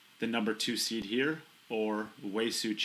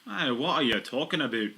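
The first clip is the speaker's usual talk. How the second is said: put-on louder, pitched-up jokey Canadian accent; mock Canadian accent; 4 semitones higher, 5 dB louder than usual. Commentator showing off a Canadian accent to co-host, hammed-up. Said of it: "My Canadian accent is the best".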